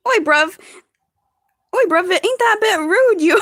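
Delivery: high, loud hammed-up British; mock British accent. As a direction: protest in an exaggerated British accent. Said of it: "I'm being British right now".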